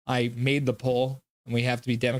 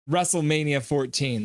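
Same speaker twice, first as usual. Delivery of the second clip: usual speaking voice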